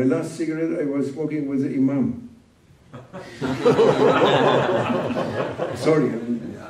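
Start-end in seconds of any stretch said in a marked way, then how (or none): 2.9-5.7 a long, open laugh at himself; real laughter breaking out; hearty laugh. Real, not acted. Laughs at own confession. 5.8-6.6 laughing apology. Real, not acted. Still laughing after confession.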